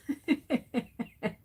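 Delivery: short amused chuckle; amused laugh. Real, not acted. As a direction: laugh at something funny. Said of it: "I'm laughing because".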